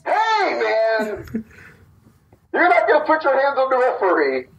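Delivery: mock babyface call-out. The babyface shouting at the heel to leave the ref alone, hammed up. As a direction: play the hero rushing in to protect the ref.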